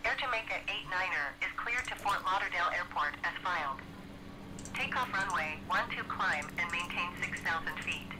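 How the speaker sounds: tinny radio voice, controller reading clearance; crisp ATC readout over the radio; air traffic control radio. Acted.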